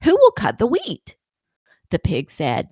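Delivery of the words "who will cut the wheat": higher and a bit louder, eager call; brisk call. A narrator's reading. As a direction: call out briskly to get helpers. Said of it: "calling briskly".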